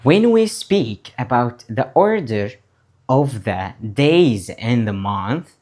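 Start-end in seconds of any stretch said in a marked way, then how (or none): none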